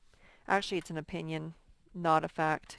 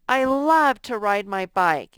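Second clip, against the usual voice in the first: excited exclamation; 2 semitones higher, 10 dB louder, slower than usual. Shown as a demonstration. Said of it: "showing strong feeling".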